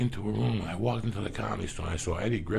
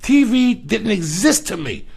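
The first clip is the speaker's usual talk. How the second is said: loud and higher, pounding out never watched; emphatic loud insistence; 10 semitones higher, 11 dB louder than usual. Real, not acted. Answering the host that he never watched Cheers, loud insistence. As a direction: insist hard, almost shouting.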